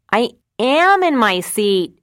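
put-on slow, high, loud shout from a dying kid; shouting back. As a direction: yell it back while playing dead. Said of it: "he shouted back".

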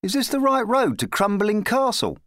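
put-on high-pitched polite boy; young lad. A narrator's reading. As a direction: ask politely as a small boy. Boy asks the knight the way.

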